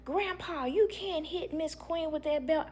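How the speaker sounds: put-on high, small child's voice; child's plea. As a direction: plead like a scared five-year-old.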